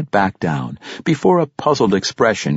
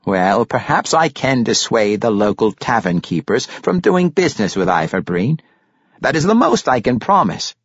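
bargaining grandfather, a little pitched up; lightly voiced Pericolo; 5 semitones higher, 4 dB louder than usual. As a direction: offer terms with mild firmness.

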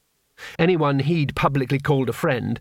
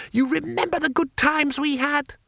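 high, eager little man; chirpy high-pitched foreigner; high tourist voice; 13 semitones higher than usual. A narrator's reading. Two Flower voiced.

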